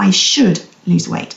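"should" drawn out slowly; stress on should. Shown as a demonstration. Coach gives listeners an example thought, slow stress on "should". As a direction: stretch and lean on "should". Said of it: "emphasis on the word should".

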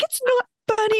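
imitating a hurt preteen, high-pitched; hammed-up high protest; indignant daughter. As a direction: whine it in mock indignation. Upset daughter objects, retold.

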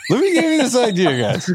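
high-pitched, laughing through the words. Real, not acted. Joking while the group laughs.

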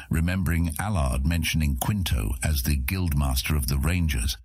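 ordinary delivery, nothing marked

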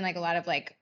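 ordinary talk, nothing marked

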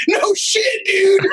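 high, drawn-out exasperated yell; exasperated retort. Retold or imitated. Comedian answering online critics of his voice, mock exasperated yell.

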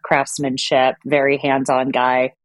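ordinary talk, nothing marked

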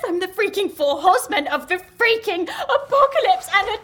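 high, laughing-crying panic; hysterical rant. Acted. She rants to her friends that she kills people, laughing through tears. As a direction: spiral into frantic half-sobbing laughter. Spiralling panic about causing deaths.

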